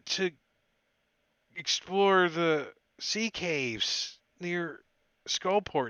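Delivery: a cold frightened prisoner stumbling over his words; shivering prisoner. Acted. Prisoner Delvin tells his story.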